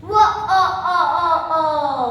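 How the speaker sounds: loud, booming stylized laughter; thunderous giant laugh. Acted. Giant announces his arrival to the village, thunderous laugh. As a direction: laugh out big, separate syllables like a giant. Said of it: "suara tawa menggelegar".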